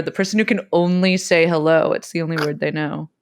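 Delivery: normal talking voice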